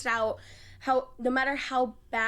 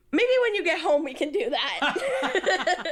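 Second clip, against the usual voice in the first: mock coach voice; 6 semitones higher, slower than usual. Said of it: "She goes".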